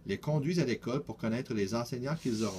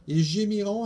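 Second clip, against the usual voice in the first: higher, louder mock moan; mock wail; 7 semitones higher, 6 dB louder than usual. A narrator's reading. Louis imagining teachers moaning, higher and louder. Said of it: "ajoute Louis".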